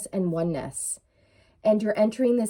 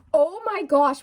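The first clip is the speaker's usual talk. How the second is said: excited; 7 semitones higher, 4 dB louder than usual. Real, not acted. Draws Ace of Cups.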